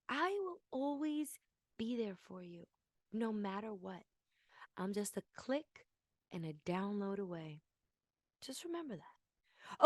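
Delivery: soft, hushed and intimate; tender promise. Acted. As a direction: murmur it close like a devoted partner. Softer, intimate repeat of promise.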